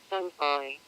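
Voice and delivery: normal talking voice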